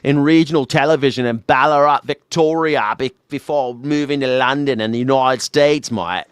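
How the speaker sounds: broad mock Australian accent. Mocking an Australian newsman's origins.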